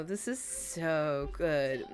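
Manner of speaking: ordinary talk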